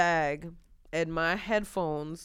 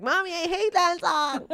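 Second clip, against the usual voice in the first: put-on higher, whiny kid's complaint; little kid voice; 10 semitones higher than usual. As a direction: play the bratty little son. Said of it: "he's gonna be like".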